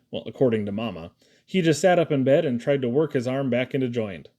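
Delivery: ordinary delivery, nothing marked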